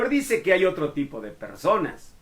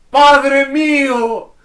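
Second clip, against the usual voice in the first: high, loud, slow anguished cry; 11 semitones higher, 13 dB louder, much slower than usual. Acted. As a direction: call out to a departing father. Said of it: "gritaba Eliseo".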